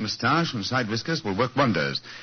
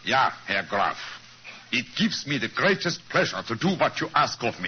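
put-on a light German accent on English. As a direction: say it as Holmes practising a German count's voice. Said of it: "Try it once again".

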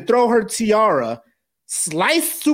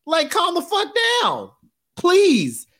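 exasperated outburst; 10 semitones higher than usual. Retold or imitated. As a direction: blow up at the absurd scene. Exasperated quote about the movie.

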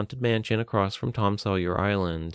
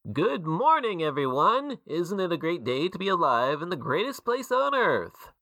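showman announcer; 8 semitones higher than usual. A narrator's reading. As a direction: greet the crowd like a cheery emcee. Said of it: "began speaking".

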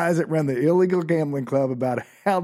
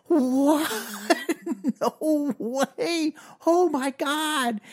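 high, laughing disbelief, slow on oh my God; stunned disbelief; 12 semitones higher, much slower than usual. Retold or imitated. Teller reacting as the boss backs down, high laughing disbelief. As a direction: squeal it out in shock, laughing.

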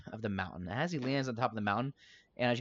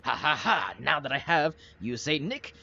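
higher, louder villain taunt; gloating villain; 4 semitones higher, 6 dB louder than usual. Acted. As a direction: laugh and gloat over a captive. Game master voices gloating villain.

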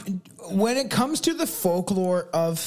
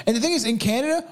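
ordinary delivery

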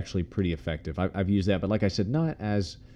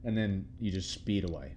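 normal talking voice

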